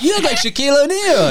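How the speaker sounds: high, goofy mock voice; mocking drunk friend. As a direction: tease a friend by aping him.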